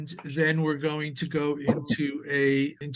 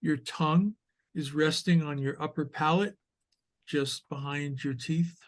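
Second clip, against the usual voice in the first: measured meditation guide with long pauses; guided meditation. Acted. Teacher leading sitters into awareness of the breath, guided meditation voice. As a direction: guide listeners gently through posture and breath, unhurried.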